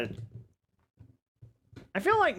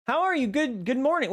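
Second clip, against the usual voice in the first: light up at seeing a friend; squeaky bright good morning; excited greeting; 10 semitones higher, faster than usual. Real, not acted. Greeting a chatter warmly.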